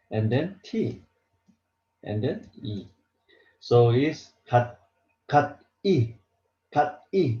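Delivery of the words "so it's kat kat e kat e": kat E sounded out as separate syllables; clumsy reading. Shown as a demonstration. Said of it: "It's very hard to pronounce".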